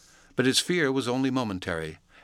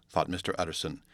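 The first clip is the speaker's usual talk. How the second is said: ordinary delivery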